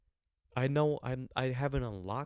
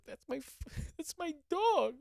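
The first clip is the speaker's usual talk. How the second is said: put-on much higher, strained whiny kid's wail; crying kid. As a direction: sob it out like a heartbroken little boy watching his dog leave. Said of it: "'Cause I'm just like".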